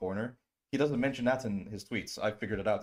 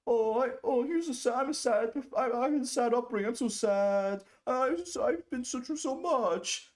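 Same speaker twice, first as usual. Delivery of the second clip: high, whiny put-on voice; mock sad victim; 12 semitones higher than usual. Streamer mocking Dylan's sob story, high whiny voice. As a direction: wail it high with fake sorrow. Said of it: "The pattern is".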